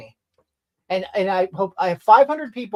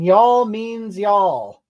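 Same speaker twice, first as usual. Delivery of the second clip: each word drawn out slowly; slow slogan; 2 semitones higher, 5 dB louder, much slower than usual. Retold or imitated. Reading a West Virginia slogan aloud, slow and deliberate.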